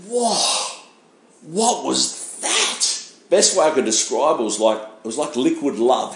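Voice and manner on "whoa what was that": much higher, very slow awed whoa; amazed whoa. Retold or imitated. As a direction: relive the stunned moment of feeling something unexplained. First feels the spirit.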